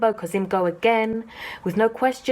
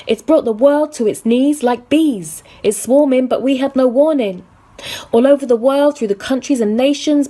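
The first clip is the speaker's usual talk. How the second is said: forceful spoken word; 3 semitones higher, 7 dB louder than usual. Acted. Loud spoken-word delivery of poem.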